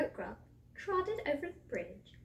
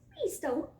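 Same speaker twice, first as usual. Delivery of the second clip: high little goat voice; pleading little goat; 7 semitones higher than usual. A narrator's reading. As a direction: beg the troll in a small high voice. Littlest goat begs for life.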